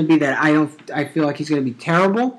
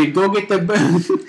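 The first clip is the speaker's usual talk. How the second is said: slow mock Boston accent; a hammed-up Boston Batman; 5 semitones higher, 4 dB louder, slower than usual. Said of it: "I can't do a Boston".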